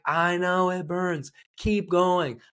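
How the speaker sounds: high, insistent trainer's push; urging coach. Retold or imitated. Coach answering a hurting client, high and insistent.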